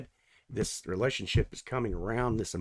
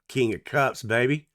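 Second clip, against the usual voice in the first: ordinary delivery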